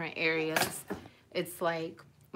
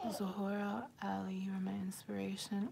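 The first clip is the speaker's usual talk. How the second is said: softly whispered; whispering a viewer's kind comment, touched; 9 dB quieter than usual. Real, not acted. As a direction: read the comment out in a moved whisper.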